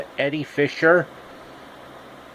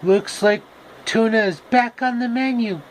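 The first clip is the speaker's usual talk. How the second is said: high comic voice; 9 semitones higher than usual. Acted.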